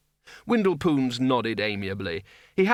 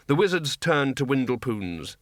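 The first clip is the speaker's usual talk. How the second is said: ordinary delivery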